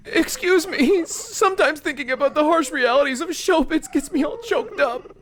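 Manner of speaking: high, sob-laughing villain who pities himself; a killer wailing crocodile tears between cackles; weepy villain voice. Acted. Voices the Weepster boss.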